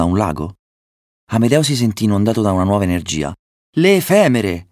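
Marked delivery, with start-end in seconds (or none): 3.8-4.7 higher-pitched cry of discovery; excited exclamation. A narrator's reading. Amedeo spots the swarm for his brothers, excited exclamation. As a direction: exclaim it with a burst of new energy. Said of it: "esclamò".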